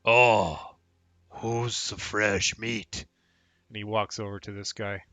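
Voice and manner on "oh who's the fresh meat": slow, lumbering ogre, loud opening groan. Acted. Ogre eyeing the captive man, slow and menacing. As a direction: drag the words out like a big ogre. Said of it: "And this, this ogre".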